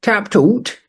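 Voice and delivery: sharp high scoff at the name; high, scornful tap toot; incredulous. Acted. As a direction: scoff in disbelief.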